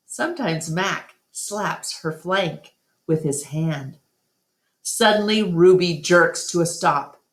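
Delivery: plain reading voice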